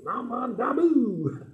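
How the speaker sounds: high, slow puppet-dog chant of the nembutsu. Acted. Puppet dog Barkley calls out the nembutsu, high and slow. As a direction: sing out the greeting like a cheerful pup.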